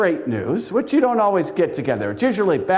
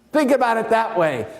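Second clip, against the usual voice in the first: ordinary talk, nothing marked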